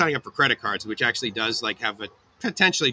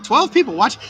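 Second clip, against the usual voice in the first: delighted surprise; 12 semitones higher, 6 dB louder than usual. Real, not acted. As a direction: pitch jumps way up, louder, thrilled. Few viewers, delighted surprise.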